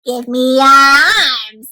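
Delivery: slow, loud robot demand; demanding orange robot. Acted. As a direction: boom it out like a big robot. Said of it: "says orange robot".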